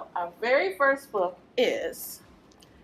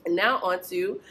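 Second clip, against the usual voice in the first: ordinary delivery, nothing marked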